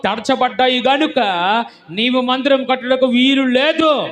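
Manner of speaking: high, loud proclamation of God's verdict; full-force preaching. Real, not acted. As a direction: thunder God's refusal from the pulpit.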